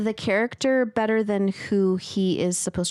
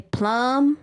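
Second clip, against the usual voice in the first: a hint of dad saying "Plum", slightly higher; impression of dad; 4 semitones higher than usual. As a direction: briefly imitate dad answering with a lone "Plum.". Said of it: "a quote of my dad, like, an impression".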